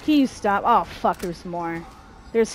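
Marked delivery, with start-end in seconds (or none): 0.0-0.6 loud, higher-pitched plea to stop; real fed-up outburst; exasperated plea. Real, not acted. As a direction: beg them to stop, raised voice. Pleading with the game mid-attack.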